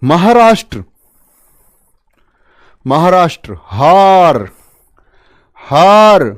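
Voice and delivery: called-out keywords. Shown as a demonstration. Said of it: "calling out mnemonic words".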